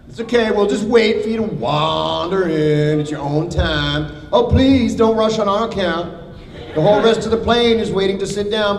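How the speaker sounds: sarcastic mom scolding the late arrival; nagging parent. Acted. A mock parent scolding a late passenger. Parental guilt-trip at latecomer.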